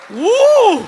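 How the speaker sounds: high, loud "woo"; loud amazed whoop. Acted. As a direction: whoop like a stunned crowd. Crowd's amazed reaction.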